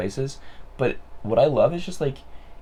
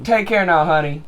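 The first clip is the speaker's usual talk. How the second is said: high, loud Southern sweetness; mock Southern farewell, pitched up; Southern accent; 6 semitones higher, 8 dB louder than usual. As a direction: sign off with a hammed-up Southern twang. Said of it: "like, a southern accent".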